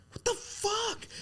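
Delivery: sudden high yelp of disbelief; mock road-rage cry. Dad shouting at a driver in traffic, high yelp.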